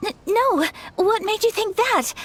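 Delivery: startled, embarrassed protest; flustered denial. Acted. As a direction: deny it a little too quickly.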